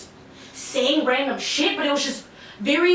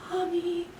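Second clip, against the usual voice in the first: faint whimper; 3 semitones higher, 8 dB quieter than usual. Retold or imitated. Paralysed, barely able to speak.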